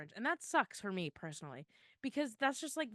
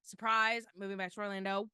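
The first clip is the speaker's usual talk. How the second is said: usual speaking voice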